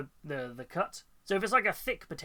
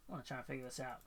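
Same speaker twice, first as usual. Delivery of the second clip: much softer, under the breath; muttered to self; 5 semitones lower, 15 dB quieter, much faster than usual. Real, not acted. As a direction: murmur it while concentrating.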